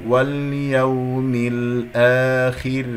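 واليوم الآخر very slow, light final ra; measured recitation of واليوم الآخر; tajweed demonstration. Shown as a demonstration. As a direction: stretch الآخر, end on a thin r. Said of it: "قبلها مكسور فتكون مرققة".

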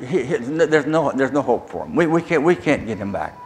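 low-pitched, retold resignation; resigned verdict. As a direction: give up on the patient, voice dropped low. Doctors giving up on him.

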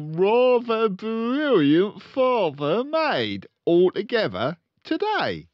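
blustering, slow, high car-dealer dad; pompous father voice; loud pompous father. Acted. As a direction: boom it out like a showy salesman.

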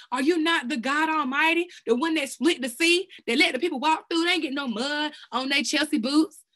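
swept up praising God; getting excited. Real, not acted. Preacher praying to God, growing excited. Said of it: "I'm getting excited".

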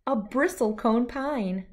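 usual speaking voice

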